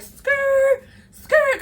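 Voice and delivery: high imitated tire squeal; tire screech. Mimicking her car skidding out of the lot.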